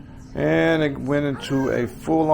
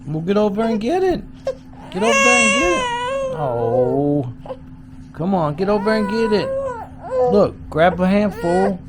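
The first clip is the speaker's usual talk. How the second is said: put-on higher, sing-song coaxing to a baby; baby talk. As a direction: coo encouragement at the little one. Parent cooing to crawling baby.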